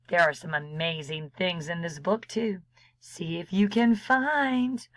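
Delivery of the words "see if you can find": ordinary delivery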